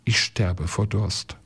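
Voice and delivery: plain reading voice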